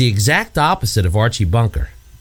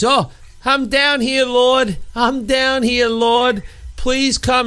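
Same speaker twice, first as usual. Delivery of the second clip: high, drawn-out plea to God; pleading prayer; 12 semitones higher, much slower than usual. Acted. Archie, locked in basement, praying for rescue, pleading. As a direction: act out a desperate sitcom prayer. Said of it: "he was praying to God".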